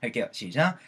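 normal talking voice

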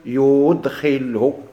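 يدخله with long stretched vowels; slow, sustained recitation of يدخله; reciting the example. Shown as a demonstration. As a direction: draw out يدخله very slowly. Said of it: "ماشي تكون".

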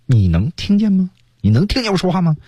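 音调抬得很高的挑衅质问; 像找茬一样高声发问. Acted. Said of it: "这本身就很挑衅的". (高调挑衅)

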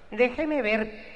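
very high, slow, haughty falsetto; wife to her compadre on the phone, high slow snooty voice. Retold or imitated. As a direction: drag out a stuck-up lady voice.

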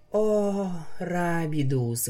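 drowsy mumbled name in sleep; sleep talking. Acted. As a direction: mumble it half asleep. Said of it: "во сне пробормотала Лира".

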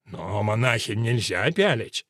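low, slow, feigned horror; mock shock. A narrator's reading. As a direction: pretend to be scandalized. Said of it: "Ральф притворился, что потрясён".

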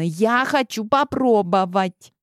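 put-on high girl voice, insisting; spoiled insistence. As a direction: demand it like a pampered girl. Clementine insists.

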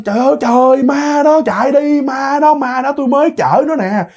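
high, wailing cries of ghost terror; acted terrified wail, held high throughout; panicked crying out. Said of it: "Ông la lên".

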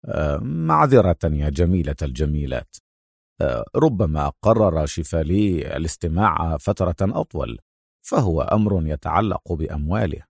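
low, smirking mockery; dry, low-pitched mock apology; sarcastic. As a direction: drop the voice and tease coolly. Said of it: "ابتسم أدهم في سخرية وقال".

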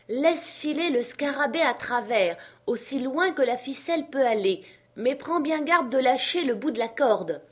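high, careful instructions; commanding master. Acted. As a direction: call orders up a tree. Legrand instructs Jupiter.

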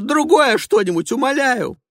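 high, loud pleading wail; desperate begging. Acted. Passenger pleading with the driver for another station, loud and high. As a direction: plead at the top of your voice. Ivanova begs for other station.

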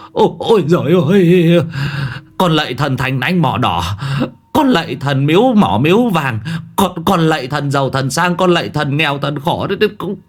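high, quavering begging for his life; terrified old man praying, pitch climbing; frightened pleading prayer. Acted. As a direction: plead desperately with the gods. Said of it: "Ông cụ chắp tay mà lạy".

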